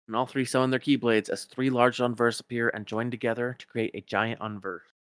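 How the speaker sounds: ordinary talk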